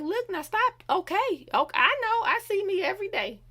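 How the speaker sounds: high-pitched, acted hurt protest; stung fourteen-year-old. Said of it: "when you fourteen and somebody crack on what you look like".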